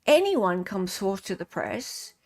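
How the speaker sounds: usual speaking voice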